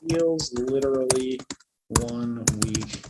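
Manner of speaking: very slow, broken by long pauses; halting, low dictation to oneself; composing aloud while typing. Real, not acted. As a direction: murmur it word by word as you type.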